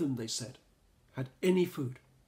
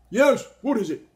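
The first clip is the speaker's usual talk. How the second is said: Monkey; 9 semitones higher, 15 dB louder than usual. A narrator's reading. Monkey answers door.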